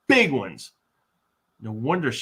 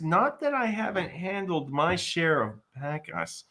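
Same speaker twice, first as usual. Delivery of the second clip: ordinary talk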